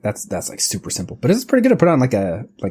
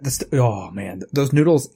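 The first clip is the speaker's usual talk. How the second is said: ordinary delivery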